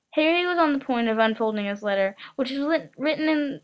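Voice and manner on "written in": usual speaking voice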